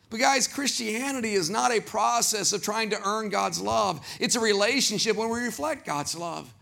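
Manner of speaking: usual speaking voice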